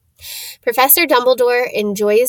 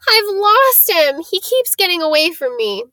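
wailing; 8 semitones higher, 4 dB louder than usual. Acted. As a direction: wail it like a lost child. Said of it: "he w-, wailed".

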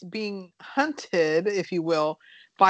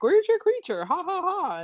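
mocking taunt; 11 semitones higher than usual. Townspeople mocking his creature story.

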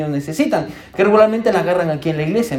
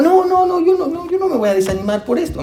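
eager reassurance; 12 semitones higher than usual. Retold or imitated. Reassuring the worried principal.

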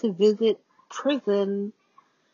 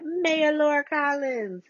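high, drawn-out proclamation of the name; sing-out the title slowly, loving it; relishing the name; 8 semitones higher, slower than usual. Real, not acted. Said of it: "I love saying that".

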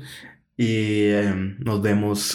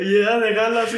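laughing; 9 semitones higher than usual. Real, not acted. Giggling over Donald voice.

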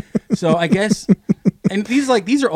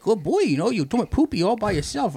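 higher sing-song praise for a dog; baby talk; 7 semitones higher than usual. Retold or imitated. Man praising his dogs on a walk, in baby talk. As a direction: coo at the dogs like babies. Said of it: "me talking baby talk to 'em".

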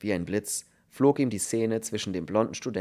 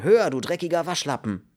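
high, loud insult; raging; 7 semitones higher, 6 dB louder than usual. A narrator's reading. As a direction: rage at a weakling. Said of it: "tobte Kern". (tobend vor Wut)

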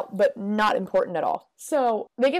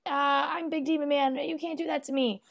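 high-pitched mock protest; lightly voiced villain whining in objection; Mister Bean; 7 semitones higher than usual. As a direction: pitch it up, indignant but playful. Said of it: "So Mister Bean's like".

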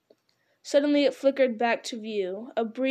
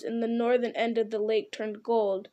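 ordinary delivery

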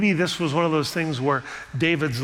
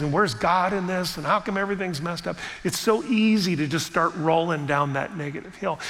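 ordinary delivery

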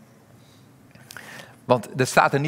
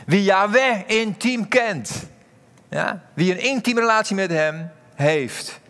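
fervent preaching swell; 7 semitones higher, slower than usual. Acted. Preacher swells on key phrase.

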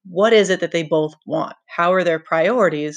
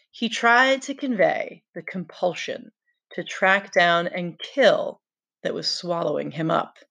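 ordinary talk

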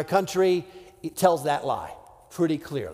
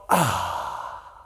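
whispered sigh of relief, much lower; relieved whisper; 10 semitones lower, 6 dB quieter, slower than usual. Acted. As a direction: whisper it like your thirst is finally quenched. Culture voicing satisfied relief.

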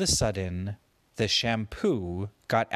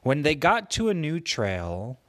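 ordinary delivery

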